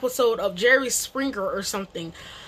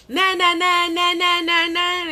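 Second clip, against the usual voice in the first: dismissive nah; 5 semitones higher than usual. Retold or imitated. Retold denial, repeated.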